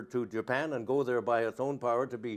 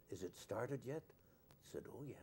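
barely audible, whispery question and answer; hushed, cathedral reverence; 16 dB quieter than usual. Retold or imitated. Visitor asking engineer whether the engine started, in a hush. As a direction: ask and answer under your breath. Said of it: "as if you were in a cathedral".

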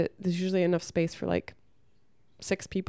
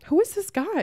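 incredulous question; 12 semitones higher than usual. Retold or imitated. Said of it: "and I said".

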